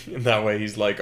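ordinary talk, nothing marked

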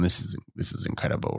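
hushed, awed whisper. Retold or imitated. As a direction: whisper it in awe. Retelling own reaction in McDonald's.

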